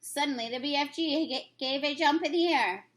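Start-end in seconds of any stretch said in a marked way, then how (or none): none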